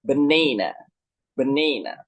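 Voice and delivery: "Banana" with the middle syllable lengthened and stressed; stress on second syllable. Shown as a demonstration. Teacher showing learners where the stress falls, middle syllable of "Banana" stressed. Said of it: "primary stress falls on the second syllable".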